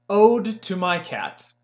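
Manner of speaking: "Ode to my cat" slow, like reading a poem; slow poetic ode. Shown as a demonstration. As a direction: recite "Ode to my cat" slowly. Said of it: "a song or a poem".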